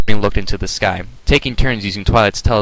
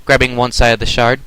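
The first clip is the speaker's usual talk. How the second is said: ordinary delivery, nothing marked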